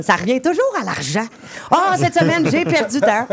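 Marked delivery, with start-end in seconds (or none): 1.7-3.3 imitating Phil. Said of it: "on demande à Phil comment ça a été sa semaine".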